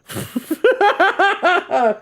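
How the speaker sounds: sustained high, rapid laugh; high giggle. Real, not acted. Laughing at the Oscar joke, high giggle.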